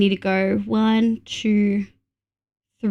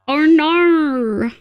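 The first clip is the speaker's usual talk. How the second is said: higher, hammed-up Australian "Oh, no"; exaggerated Australian accent; 4 semitones higher than usual. Shown as a demonstration. Streamer mimicking what everyone else hears, exaggerated Australian accent. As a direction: ham up the Australian vowels in "Oh, no". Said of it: "he is the Australian accent".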